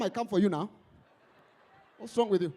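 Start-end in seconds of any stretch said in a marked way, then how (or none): none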